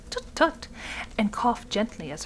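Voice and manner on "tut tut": disapproving tut. A narrator's reading.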